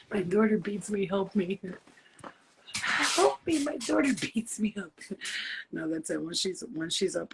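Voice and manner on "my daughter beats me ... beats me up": high, hammed-up cry for help; mock plea for help. Mother joking to viewers her daughter beats her, high mock plea. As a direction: play the helpless victim for laughs.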